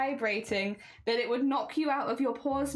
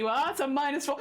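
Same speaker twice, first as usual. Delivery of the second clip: normal talking voice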